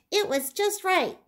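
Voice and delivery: delighted reveal. A narrator's reading.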